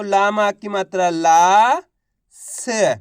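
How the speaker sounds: slow syllable by syllable; slow sounding out. Shown as a demonstration. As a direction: build the word slowly. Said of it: "teacher sounding out syllables". (धीमे-धीमे अक्षर जोड़ते हुए)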